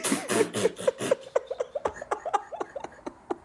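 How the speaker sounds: high airless wheezing laugh; totally losing it; helpless laughter. Real, not acted. Cracking up after the punchline.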